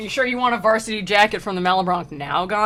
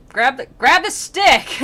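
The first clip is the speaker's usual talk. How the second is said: loud, high, drawn-out command; mock impatient urging; 6 semitones higher, 9 dB louder, slower than usual. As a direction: call it out in cartoon exasperation. Acted rescuer urging.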